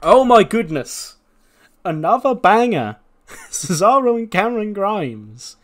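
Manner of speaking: delighted, high and loud; thrilled by a great match; excited. Real, not acted. Hosting a recap, gushing over a banger match, excited. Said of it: "excited about match rating".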